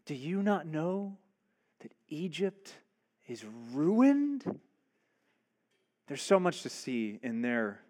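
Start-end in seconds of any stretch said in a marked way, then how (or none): 0.1-4.6 hushed warning. Acted. Servants' warning, dropped low.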